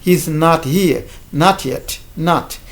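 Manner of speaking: not as American "naht"; American English vowel. Shown as a demonstration. Teacher modelling American English for French speakers, American open ah. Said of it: "c'est American English".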